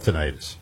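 tinnitus as tuh-NIGH-tus; tinnitus, middle syllable sounding like "night"; long-I pronunciation. Shown as a demonstration. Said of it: "It was called".

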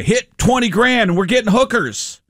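high-pitched, eager retold phone invitation; excited buddy. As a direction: act out a buddy urging a friend out.

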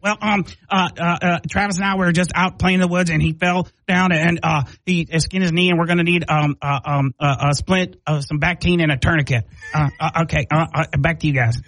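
put-on stammering little-kid earnestness; Patrick Mahomes. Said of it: "And Patrick Mahomes".

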